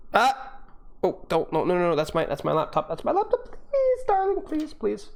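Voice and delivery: falsetto pleading with the cat, laughing; panicked high plea to a cat; squealed please at a cat on the laptop; high squeaky pleading. Real, not acted.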